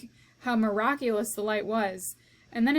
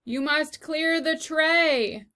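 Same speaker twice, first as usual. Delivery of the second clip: slow, loud, higher shout; 6 semitones higher, 5 dB louder, much slower than usual. Acted. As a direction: bellow each word slowly as if to a fool. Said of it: "shouted Cook".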